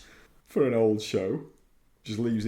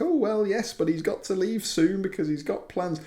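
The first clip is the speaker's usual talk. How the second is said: higher fussy secretary voice; secretary fobbing off the visitor, pitched higher; 8 semitones higher than usual. Retold or imitated. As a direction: fret politely, voice pitched up. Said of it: "he's like".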